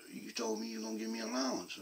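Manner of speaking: put-on boy pleading for his promised allowance; son's voice. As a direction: pitch up into a kid reminding dad of a promise. Son's voice quoted by father.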